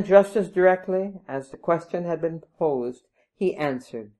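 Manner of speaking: ordinary delivery, nothing marked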